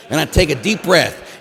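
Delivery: usual speaking voice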